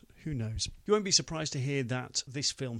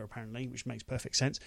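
ordinary delivery

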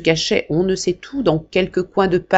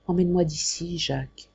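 barely audible, much softer plea; frightened boy begging his brother, almost inaudible; very quiet; 7 dB quieter than usual. Acted. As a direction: ask so softly he can hardly hear. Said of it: "dit tout bas, si bas que son frère put à peine l'entendre".

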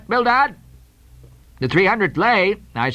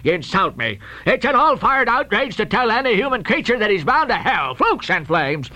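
high, wailing outraged roar; explosive, blustering tirade with drawn-out cries; thundering fury; 9 semitones higher than usual. Acted. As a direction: rant as a mortally insulted sea captain. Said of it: "he thundered out this".

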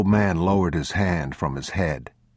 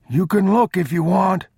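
put-on high-pitched old traveler; wary old man; 14 semitones higher, 4 dB louder than usual. A narrator's reading. Old traveler to strangers he takes for robbers, high old-man voice. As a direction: call out high, defending yourself.

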